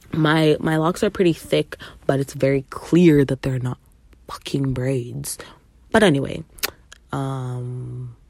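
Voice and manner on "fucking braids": whispered aside. Real, not acted. Emphatic aside.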